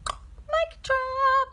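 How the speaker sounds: a high, put-on "mic drop" verdict; mock mic drop. Reacting to Tony Robbins videos, a high mock mic drop. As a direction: toss off "mic drop" as the last word on it. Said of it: "I'm like".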